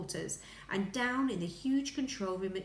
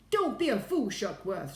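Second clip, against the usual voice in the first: angry shout; 3 semitones higher, 7 dB louder than usual. A narrator's reading. Ground Control rebuking the astronaut, angry shout. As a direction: shout him down. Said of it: "shouted Ground Control".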